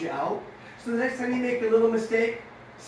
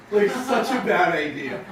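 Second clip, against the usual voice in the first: laughing; 2 semitones lower, 5 dB louder than usual. Real, not acted. Laughing at own remodel.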